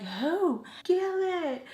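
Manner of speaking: high-pitched mock melodrama; hammed-up, swooping retold exchange; super dramatic. Telling mom who got beaten, super dramatic. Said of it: "Like, super dramatic".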